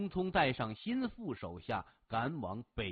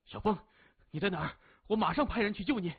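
higher, urgent, ending in a fast rush; frantic father; 7 semitones higher, 3 dB louder, much faster than usual. A narrator's reading. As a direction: blurt it out in alarm to your son. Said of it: "急得跳起多高". (父亲焦急万分，语速急促)

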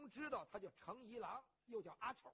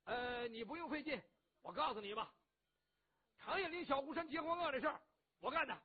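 put-on loud, higher, bragging to his old friend; blustering rough hero; 4 semitones higher, 5 dB louder than usual. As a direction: boom it out, boastful and warm-hearted. Cheng confesses boldly.

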